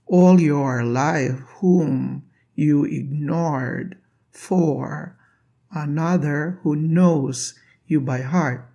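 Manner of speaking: teacher reciting the poem line slowly to show the oo sound, drawn out; slow poem reading. Shown as a demonstration. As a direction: read the line very slowly, savouring the vowels. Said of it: "the sound of "oo" in".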